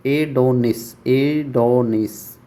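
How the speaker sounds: एडोनिस said very slowly, syllable by syllable; teacher modelling एडोनिस at a crawl; slow pronunciation. Shown as a demonstration. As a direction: spell out एडोनिस by sound, slowly. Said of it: "pronunciation इसका एडोनिस".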